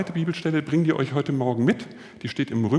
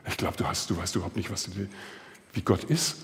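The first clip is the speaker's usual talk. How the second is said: quiet reply; 5 semitones lower, 6 dB quieter than usual. Retold or imitated. Student answering quietly.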